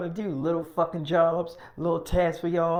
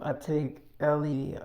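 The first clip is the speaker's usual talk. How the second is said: ordinary delivery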